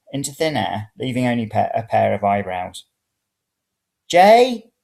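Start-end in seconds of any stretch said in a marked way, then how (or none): 4.1-4.7 calling up the stairs. Acted. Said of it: "I called up the stairs".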